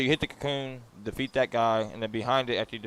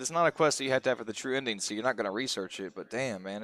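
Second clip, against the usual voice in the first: ordinary talk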